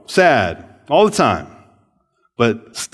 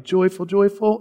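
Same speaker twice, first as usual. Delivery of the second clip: mock timid song; 8 semitones higher than usual. Shown as a demonstration. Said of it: "a little, like".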